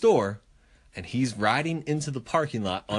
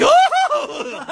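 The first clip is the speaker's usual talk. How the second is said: startled yelp; 8 dB louder than usual. Retold or imitated. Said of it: "he's like".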